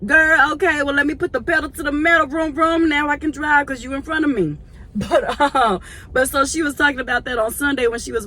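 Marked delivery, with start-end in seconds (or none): 0.0-2.9 high, loud and fast; hammed-up lead-foot driver; mock speeding up. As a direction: rev it up playfully. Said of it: "mock revving".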